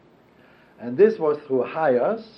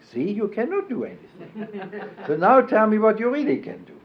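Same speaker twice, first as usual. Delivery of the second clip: put-on faint Russian accent; 8 semitones higher than usual. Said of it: "she had some Russian accent".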